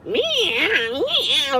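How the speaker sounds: a high fussing meow, copying the cat; imitated cat meow. Owner imitates her cat fussing when she comes home late, with a high meow. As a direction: mew like an upset cat complaining. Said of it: "like, fussing at me".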